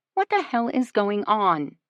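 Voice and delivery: usual speaking voice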